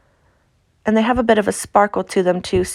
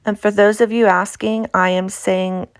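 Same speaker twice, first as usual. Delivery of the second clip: ordinary delivery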